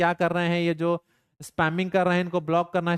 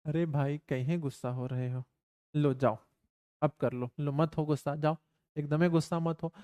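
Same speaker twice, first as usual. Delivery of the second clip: soft coaxing aside; 3 semitones lower, 7 dB quieter than usual. Real, not acted. Coaxing someone off-mic to calm.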